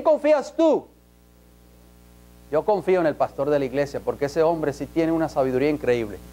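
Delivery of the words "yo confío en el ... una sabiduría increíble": lower, softer, quick mock churchgoer; lightly voiced member praising the pastor; imitating churchgoers. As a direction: toss it off like a naive believer. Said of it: "dicen muchos".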